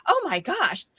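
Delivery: high, amazed mock gasp of wonder; surprised outsiders. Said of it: "people saying to us".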